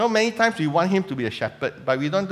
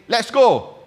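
lightly acted shepherd calling the flock, higher and louder; shepherd's call; 6 semitones higher, 5 dB louder than usual. Shepherd telling his sheep to move, lightly voiced call. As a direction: call out a brisk mock summons. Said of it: "the shepherd will say".

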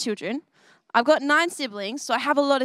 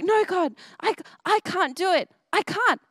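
higher, lightly voiced pleading; nervous Moses; 6 semitones higher than usual. Moses begging God to pick someone else, nervous pleading. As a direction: plead like a nervous man who feels unable. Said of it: "I'm too nervous".

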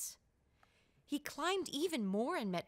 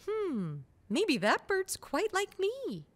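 musing bear cub; 7 semitones higher, 4 dB louder than usual. Acted. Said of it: "and Blue thought".

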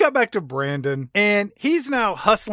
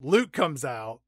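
normal talking voice

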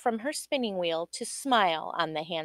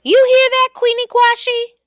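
much higher, louder frantic wail; mama's fretful cry; 11 dB louder than usual. Acted. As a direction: fret aloud to her daughter. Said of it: "cried the mama".

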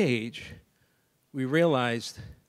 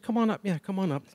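higher, gentle encouraging come on up; coaxing invitation; 5 semitones higher than usual. Retold or imitated. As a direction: coax a nervous kid up, kindly. Coaxing a shy girl onstage.

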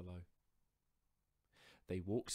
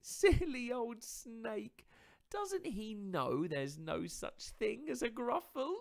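higher, louder, teasing mouse voice; smug little mouse; 10 dB louder than usual. Acted. Mouse mocking the fled fox, higher and smug.

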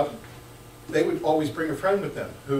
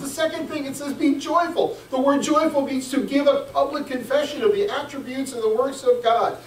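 much higher, forceful preaching voice; fired-up preaching; 11 semitones higher, 6 dB louder than usual. Real, not acted. Preacher urging the congregation to take the word study seriously, fired-up preaching. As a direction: preach it high and urgent, punching key words.

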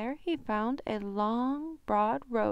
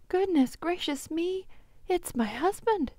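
flustered panic; 6 semitones higher than usual. Acted. Giant woman panics.

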